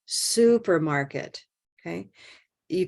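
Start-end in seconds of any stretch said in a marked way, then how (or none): 0.1-1.4 Supermarket, slowly, holding the stressed vowel long; time on stressed vowel. Shown as a demonstration. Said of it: "we're going to use time in English".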